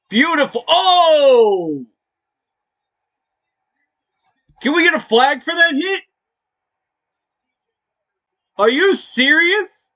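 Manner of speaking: high, loud disbelieving yells; outraged fan. Real, not acted. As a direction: yell at the screen in disbelief. Reacts to big hit.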